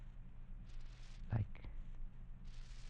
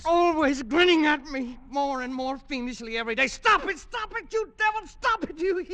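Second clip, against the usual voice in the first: shrieking, far higher and louder, frantic; hysterical screaming; 8 dB louder than usual. Acted. Pollock screaming at the grinning vase, hysterical. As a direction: scream at the devil in blind terror.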